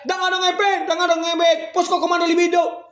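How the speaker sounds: shouting the protesters' demands in mock outrage; imitated yelling. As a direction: yell it like an angry crowd chanting. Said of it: "teriak-teriak".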